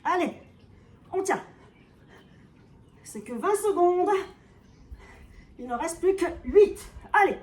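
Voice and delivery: loud, pushing the class on; rally them to finish the hold; loud encouragement. Real, not acted. Coach pushing class through hold.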